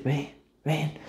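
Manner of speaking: barely above a breath, call them in; God's gentle call. Retold or imitated. God inviting the sinner, very soft. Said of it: "Dios va a decir".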